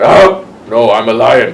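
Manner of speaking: loud, mock-offended outburst; indignant protest. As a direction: act out a proud, affronted objection. Acting out offended reply to insult.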